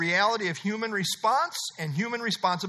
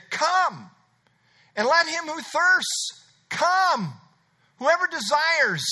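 urgent invitation; 7 semitones higher, 4 dB louder, slower than usual. Acted. Preacher voicing the church's invitation.

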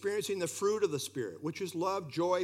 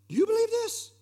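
high, loud challenge to the listeners; pitched-up, insistent question; pointed question; 13 semitones higher, 5 dB louder than usual. Acted. Puts the verse's question to listeners.

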